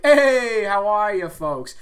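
high, loud sing-song hey how are ya; podcast intro greeting. Retold or imitated. Coworker's greeting he borrows for the show intro, loud and high. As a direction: belt out a cheery host greeting. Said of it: "that's where I get the intro".

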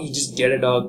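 normal talking voice

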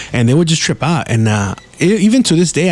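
ordinary talk

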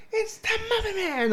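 high, slow, wailing Muffin Man; loud wailing guy. Retold or imitated. Friend imitates the patient across the room, high slow wail. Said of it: "all loud and shit".

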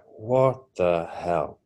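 slow, soft, stunned; retold bewilderment. Recalling standing on a plinth in space, stunned. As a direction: spell it out quietly in mock disbelief. Sees self in strange place.

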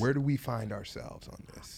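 playful hushed whisper. Pretend. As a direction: whisper it into the mic. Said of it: "I'm gonna talk like Joe Biden".

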